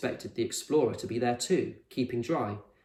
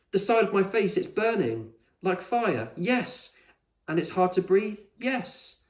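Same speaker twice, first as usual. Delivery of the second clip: much higher, urgent and winded; dramatic alarm; 8 semitones higher, 4 dB louder than usual. A narrator's reading. Con doubled over, fearing she's dying. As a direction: plead as if in danger.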